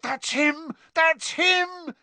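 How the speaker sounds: high, urgent fan's cry; excited yell. A narrator's reading. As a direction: yell it in thrilled recognition. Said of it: "yelled Ron".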